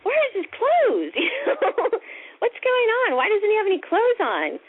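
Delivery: high-pitched, incredulous inner voice; astonished kid. Retold or imitated. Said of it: "in my kid's mind".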